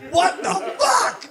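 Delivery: high and slow disbelieving cry; incredulous outburst. Acted. Comic to audience, baffled by humanity, high slow outburst. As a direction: throw it out in disbelief.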